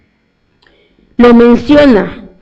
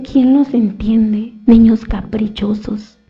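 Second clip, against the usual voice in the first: weary exasperation; 4 semitones lower, 5 dB quieter, slower than usual. Retold or imitated. Jesus sighs at the generation.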